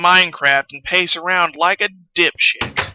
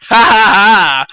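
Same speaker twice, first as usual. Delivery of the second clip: triumphant laugh; 3 semitones higher, 6 dB louder, slower than usual. Real, not acted. Triumph after finding enough iron.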